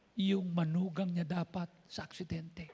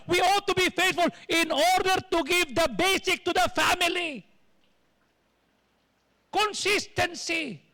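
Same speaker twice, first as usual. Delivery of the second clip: louder, higher preacher's exhortation; full-force sermon, pressing the faithful; fiery preaching; 7 semitones higher, 5 dB louder than usual. Acted. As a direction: drive it home from the pulpit.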